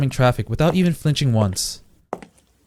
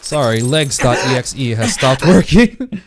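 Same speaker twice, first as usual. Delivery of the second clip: mock error message.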